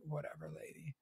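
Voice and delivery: soft, dismissive "whatever, lady"; muttered dismissal. Retold or imitated. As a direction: brush her off under your breath. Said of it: "I was like".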